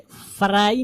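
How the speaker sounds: फ्राई split as "fa-RAA-ee"; फ्राई opened up to "farai"; village pronunciation. Shown as a demonstration. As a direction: add a vowel between f and r in फ्राई. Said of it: "यहाँ पे फ्राई नहीं".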